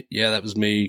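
ordinary talk

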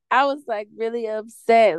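normal talking voice

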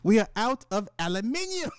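high put-on British voice; hammed-up British announcement; mock British accent. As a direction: declare it as a pretend Brit, pitched high. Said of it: "imagine me telling the wife".

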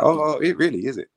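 high-pitched surprised reaction; surprised interest. Retold or imitated. Player answering the new coach, surprised. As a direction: act out being taken by surprise.

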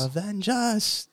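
high, slow dramatic plea; dying plea. Retold or imitated. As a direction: plead it out slowly. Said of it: "Inquisitor saying".

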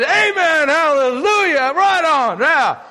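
high, loud jubilant cheer; joyful shout. Retold or imitated. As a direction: cheer like a revival crowd. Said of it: "everyone shouted".

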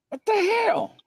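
high startled cry of alarm; shocked outburst. Retold or imitated.

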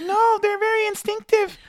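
very high, slow mock girlfriend voice; imitated girlfriend. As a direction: parody her in a drawn-out squeal. Mimics girlfriend defending the horse.

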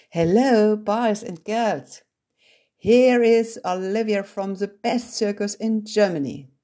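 high, loud English showgirl announcement; showy circus girl. Acted. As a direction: announce yourself like a circus star. Olivia announces herself to class. (laute, hohe Zirkusansage mit englischem Akzent)

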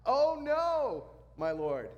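loud, high cry of dismay; high wail dropping off on the last words; acted yell. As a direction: yell it out as if disaster just struck. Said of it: "So he yells".

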